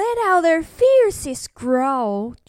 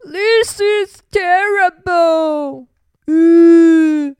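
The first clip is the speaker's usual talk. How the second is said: loud, drawn-out mock wail; moaning; 3 semitones higher, 7 dB louder, slower than usual. A narrator's reading. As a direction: moan it slowly and loudly, with a wailed "uh". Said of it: "he moaned".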